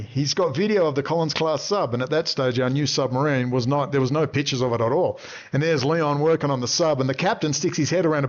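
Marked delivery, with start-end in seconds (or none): none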